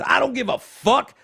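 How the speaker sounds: high-pitched mock rant; imitated angry passenger. Host reading out the triggered passenger's words, mock angry rant. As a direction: read it out as a hammed-up outburst. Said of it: "the passenger said".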